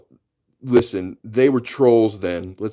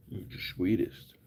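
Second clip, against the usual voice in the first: whisper; 10 dB quieter than usual. Real, not acted. Whispering to baby.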